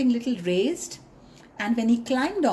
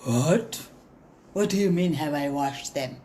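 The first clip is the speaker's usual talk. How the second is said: lion voice; 7 semitones lower than usual. Acted. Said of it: "So the lion said".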